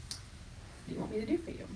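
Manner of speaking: whispered, gentle question; hushed Jesus. Acted. Jesus softly asking the two disciples in a whisper. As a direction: breathe it softly, like Jesus leaning in.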